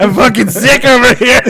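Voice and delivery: hammed-up complaining cat; whiny sick cat. Voicing the sick cat for a friend, loud high laughing whine.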